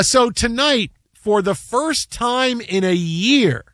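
slow, deliberate broadcast lead-in; slow dramatic sports intro; sports announcer build-up. Real, not acted. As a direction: stretch it out to set up the highlight. Builds up to game highlight.

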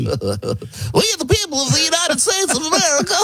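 extremely high helium squeak; James Madison. Acted. Tiny founder reading the preamble, helium squeal. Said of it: "sucks down helium and then starts to read the preamble".

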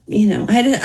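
usual speaking voice

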